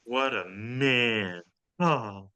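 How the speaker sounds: slow, drawn-out dreamy sigh; lovestruck, lingering murmur of admiration; swooning admiration. Acted. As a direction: stretch it out low, smitten. Waitress swoons after generous tip.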